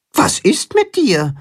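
a high, concerned little hen voice; worried chicken voice. Acted. Hen asks the crying carp, high and worried. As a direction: ask gently, full of worry. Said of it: "fragt Timme besorgt".

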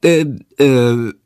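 helpless stammer, trailing into brr; boy stammering, lost for a name. A narrator's reading. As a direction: stall hopelessly, blank. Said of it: "Jendrik stottert".

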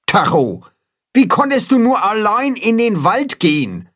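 high-pitched reproachful telling-off; scolding. A narrator's reading. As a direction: scold a little one who ran off. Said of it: "schimpft Holstein mit dem kleinen Tacho".

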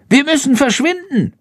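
high squeaky urgent order; commanding bat voice. A narrator's reading. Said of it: "befiehlt Chandor".

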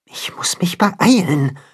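urgent whisper to himself; hushed and slowed, so the wolves don't hear. Acted. Hero hurries unseen past wolves.

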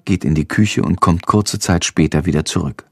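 usual speaking voice

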